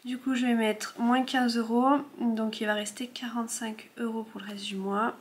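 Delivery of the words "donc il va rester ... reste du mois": murmured to herself while counting; whispering. Real, not acted. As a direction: whisper the total under your breath.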